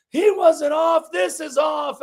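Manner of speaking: mock a rival fan's indignant protest; protesting rival fans. Acting out protesting rival supporters.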